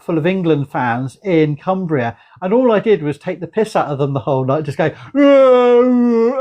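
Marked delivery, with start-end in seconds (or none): 5.1-6.4 loud, high football-fan roar; a cheeky loud roar copying the crowd; mock crowd roar. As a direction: bellow it like a pub full of England fans. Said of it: "I copied all the noise".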